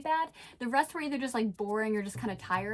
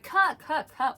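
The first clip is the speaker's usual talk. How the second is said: loud, higher-pitched retold cut call; director calling cut; 5 semitones higher, 5 dB louder, slower than usual. Director stopping a tennis player mid-shoot, calling out.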